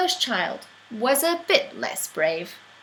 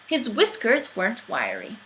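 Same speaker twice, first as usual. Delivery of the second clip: usual speaking voice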